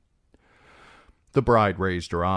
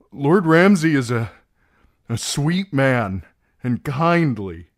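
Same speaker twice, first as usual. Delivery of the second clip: louder, tense pleading; urgent insistence; 6 semitones higher, 7 dB louder than usual. Acted. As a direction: press her hard, afraid of Ramsay. Theon urges her, agitated.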